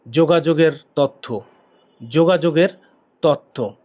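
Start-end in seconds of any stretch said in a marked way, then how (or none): none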